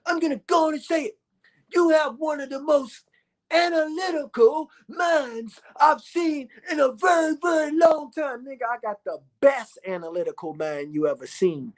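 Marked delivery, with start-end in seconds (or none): 0.1-8.4 imitating an older mentor. Retelling a man's praise in a high mock voice. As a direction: mimic the man who praised him, pitched way up. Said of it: "He said".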